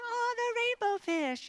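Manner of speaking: high, squealing mock excitement; excited squeal. Youth pastor echoing the crowd, squealing with excitement. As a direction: gush like a thrilled kid.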